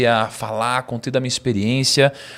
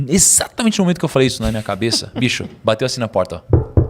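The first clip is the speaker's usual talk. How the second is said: ordinary talk, nothing marked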